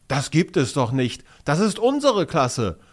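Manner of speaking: loud, high, amazed outburst; rising excitement, blurted in surprise; surprised. A narrator's reading. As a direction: burst out in disbelief. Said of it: "stößt Finn überrascht hervor".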